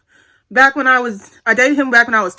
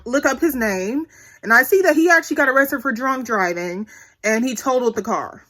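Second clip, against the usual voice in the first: ordinary delivery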